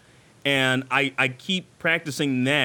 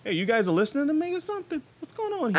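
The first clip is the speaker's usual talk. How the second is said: acted reaction; 10 semitones higher, faster than usual. Answers neighbors mimicking him, acted.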